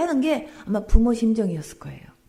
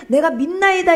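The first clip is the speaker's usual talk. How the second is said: loud, higher declaration of faith; firm confession; 6 semitones higher, 8 dB louder than usual. Retold or imitated. Pastor voicing the confession the father should have made, loud. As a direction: declare it boldly as the right answer. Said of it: "이렇게 고백하지 않고".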